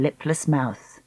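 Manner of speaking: usual speaking voice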